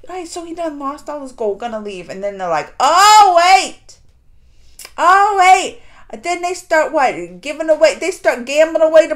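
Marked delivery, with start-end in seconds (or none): 2.8-5.8 mock call-back. Villains calling him back.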